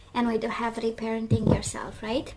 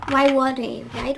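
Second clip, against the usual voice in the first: louder, punchy rhetorical question; emphatic; 7 dB louder than usual. Real, not acted. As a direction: throw the question out boldly. Rhetorical cheer, then soft echo.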